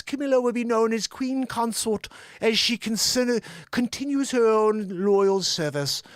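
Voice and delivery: measured, hammed-up high English; the Queen. Host reading the Queen's wish for Camilla, high English accent. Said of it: "You need a high English accent when you're reading the Queen".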